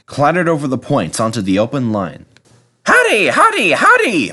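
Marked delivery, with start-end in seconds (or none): 2.8-4.3 very high, loud puffing chant; a proud engine racing along; bouncy high howdy; James puffing cheerfully. A narrator's reading. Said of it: "puffed James".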